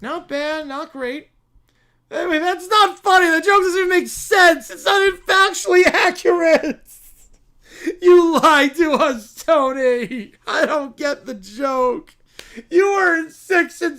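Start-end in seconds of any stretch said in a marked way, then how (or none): 2.1-6.8 put-on bratty child. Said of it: "the Brat Pack". 8.0-10.3 loud, slow whiny accusation; bratty child. Acted. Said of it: "the Brat Pack".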